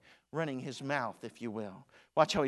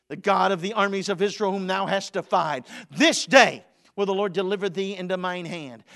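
loud preaching of David's defiance; David answering Goliath boldly; defiant proclamation; 4 semitones higher, 12 dB louder than usual. Acted. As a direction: declare it full force. David's defiance read aloud.

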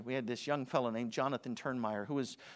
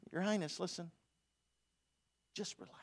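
David calmly soothing King Saul; cool teen David; 8 dB quieter than usual. Retold or imitated. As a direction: shrug it off for the king. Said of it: "And he says".

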